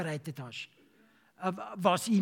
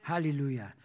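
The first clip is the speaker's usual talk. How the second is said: ordinary talk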